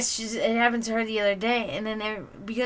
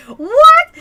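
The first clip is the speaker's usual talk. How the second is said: much higher, shouted what; boss's wife's surprise; 16 dB louder than usual. Retold or imitated. Boss's wife hearing the joke, shrill surprised what. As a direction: shriek it in disbelief. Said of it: "she's like".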